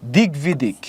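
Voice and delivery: "দিক বিদিক" said dig-bidik, the b sounded; g before sounded b. Shown as a demonstration. Teacher introducing the example, "দিক বিদিক" pronounced dig-bidik. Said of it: "এখানে গ হবে".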